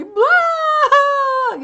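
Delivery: loud, high, hammed-up fake bawl; mock wailing sob burst. Shown as a demonstration. Mocking actors who force tears, a hammed-up loud wail. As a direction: wail out a fake cry, overdone. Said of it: "You don't just usually".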